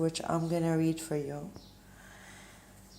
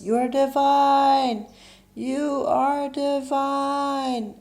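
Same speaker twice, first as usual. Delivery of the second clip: high, loud, drawn-out call; long, ringing holler from on high; God's mountaintop yell; 9 semitones higher, 7 dB louder, slower than usual. Acted. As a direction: yell it slowly from a mountaintop, stretching each word. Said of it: "God stands on a mountain top and yells".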